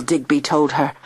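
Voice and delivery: ordinary delivery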